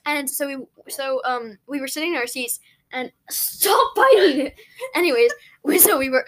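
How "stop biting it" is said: high, drawn-out protest; stretched-out, exasperated squeal; annoyed shout. Real, not acted. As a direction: yell at a pest to quit it. Scolding the one biting her.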